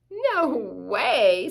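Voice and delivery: mock scorn from the fox; scoffing fox. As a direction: scoff at him like a smug fox.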